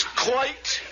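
put-on one unruffled word in an English accent; nonchalant English accent. Joker mimics the film husband answering, nonchalant English. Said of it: "Everybody's so nonchalant".

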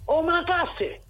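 put-on high child's call of omakase; child calling to mum. Host acting himself as a boy calling to mum, high and drawn out. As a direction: sing out omakase like a kid at the kitchen door. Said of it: "voy a hacer otra vez el diálogo".

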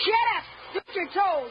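high, angry shouted order; bullying command. Acted. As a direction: shout the smaller boy down. Bully orders the smaller boy.

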